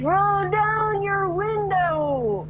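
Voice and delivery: high, drawn-out holler car to car; calling out. Retold or imitated. Stranger hollers to a driver in the next car, calling out. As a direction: stretch every word in a high mock yell.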